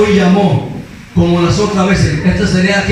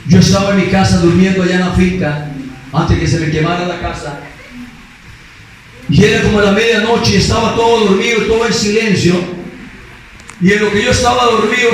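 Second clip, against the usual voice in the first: usual speaking voice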